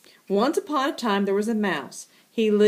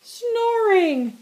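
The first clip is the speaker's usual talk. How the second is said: mock snore; 11 semitones higher, 3 dB louder than usual. Mimicking a sleeping cat.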